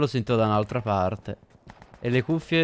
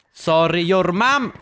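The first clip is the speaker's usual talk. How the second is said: mocking taunt; 6 semitones higher, 6 dB louder, slower than usual. Real, not acted. Taunting another player.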